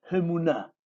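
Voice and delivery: Hemuná with a breathed h before the E; aspirated first E. Shown as a demonstration. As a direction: demonstrate Hemuná with an aspirated opening. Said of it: "La E primera es como que se aspira".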